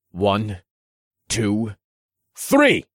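building count to a loud high yell. A narrator's reading. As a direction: shout the last number. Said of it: "I yelled".

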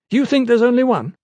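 put-on high, loud, scornful young scholar; mocking scholar. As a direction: sneer at the newcomer.

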